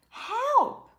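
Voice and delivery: loud high cry for help; shouted help. Shown as a demonstration. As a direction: yell it as if someone drowns. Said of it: "Shout é quando você grita".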